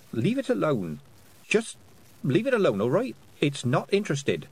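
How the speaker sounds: put-on earnest girl pleading; Tiffany's voice. A narrator's reading. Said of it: "said Tiffany".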